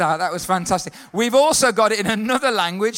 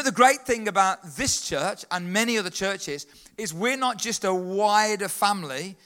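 usual speaking voice

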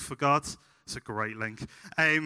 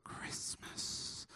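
mum's hushed whisper; voiceless stage whisper; 20 dB quieter than usual. Retold or imitated. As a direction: whisper it like a secret signal.

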